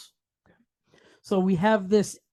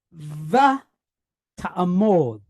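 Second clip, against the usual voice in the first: va set apart, then ta'amod with stressed final mod; stressed syllables; 3 semitones lower, much slower than usual. Shown as a demonstration. Teacher showing students the stress, va and ta'amod split and stressed. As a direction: lean on va, pause, then ta'amod. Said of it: "You hear the stress?".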